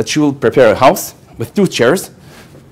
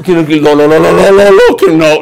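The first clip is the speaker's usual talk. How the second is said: panicked no; 6 dB louder than usual. Retold or imitated. Retold panicked refusal.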